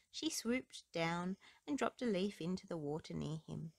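usual speaking voice

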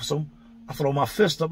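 ordinary talk

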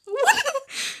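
strange, very high giggle; odd laugh. Pretend. A laugh that gets an "ew" from friends, high and odd. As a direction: let out an odd high cackle. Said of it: "who laughed like that?".

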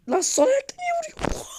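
outraged disbelief. Real, not acted. Gamer crying out at a left-footed shot.

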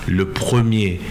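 usual speaking voice

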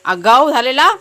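loud, high telling-off; scolding shout. Real, not acted. Owner scolding cheeky dog Sheru, loud.

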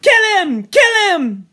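high, very loud yell; frenzied shout. Acted. Boys carried away attacking Robert, frenzied yelling. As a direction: scream for the kill.

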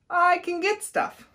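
high, slow eager iguana; lightly voiced eager iguana. A narrator's reading. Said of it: "said Iguana".

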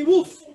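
high, loud woof; dog woof. Shown as a demonstration. Coach calling animal sounds for stretch kicks, loud woof. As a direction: bark out a single woof. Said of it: "only doing animal sounds".

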